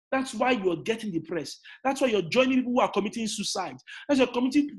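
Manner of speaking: usual speaking voice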